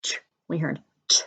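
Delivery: the ch sound whispered. Shown as a demonstration. Teacher whispering the digraph for young readers. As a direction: breathe out the ch sound. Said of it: "what digraph did we hear".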